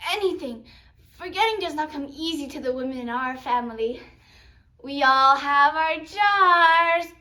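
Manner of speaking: loud, emphatic grandmother voice; grandmother Popo. Acted. Popo tells her grandchild about the family's women, loud and emphatic.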